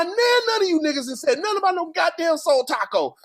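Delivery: ordinary talk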